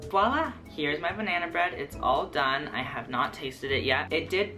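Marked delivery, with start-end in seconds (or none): none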